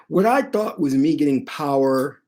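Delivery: ordinary delivery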